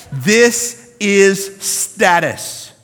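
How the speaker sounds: This is status, very slow and weighty; emphatic. Real, not acted. Preacher hammering the point to the congregation, slow and emphatic. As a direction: land every word of This is status slowly.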